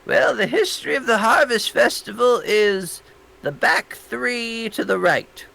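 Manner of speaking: high, lightly voiced old librarian; prim old woman pointing the way; old lady voice. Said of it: "She's like".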